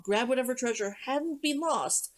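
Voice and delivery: usual speaking voice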